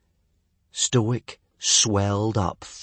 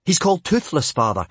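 higher, louder, anxious interjection; hurried; 7 semitones higher, 5 dB louder, faster than usual. Acted. Son correcting his angry father, hurried. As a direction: jump in quickly to correct him. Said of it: "Hiccup said hurriedly".

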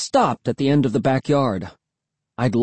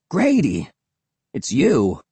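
relieved cry; slower than usual. A narrator's reading. Will finding a friend in the dark, crying out. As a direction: cry out in recognition. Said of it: "he cried".

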